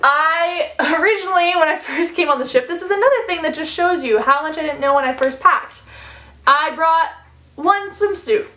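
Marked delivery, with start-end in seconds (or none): none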